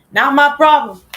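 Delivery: loud sing-song brush-off; cheeky loud dismissal; not my problem. Pretend. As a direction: toss it off like it's none of your business. Mocking chant of a phrase.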